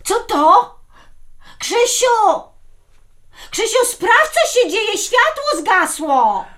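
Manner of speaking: high-pitched startled call for help; alarmed calling out. Acted. Wife calling her husband when lights go out, alarmed.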